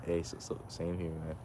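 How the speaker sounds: ordinary delivery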